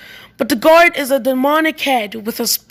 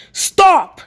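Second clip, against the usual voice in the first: higher-pitched, sudden "stop" call; calling out a halt; 5 semitones higher, 3 dB louder than usual. A narrator's reading.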